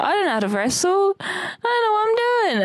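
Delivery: high panicky wail, rising to a squeak; panicked. Retold or imitated. Freaking out before a wrestling match, high panicky wail. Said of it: "I was like".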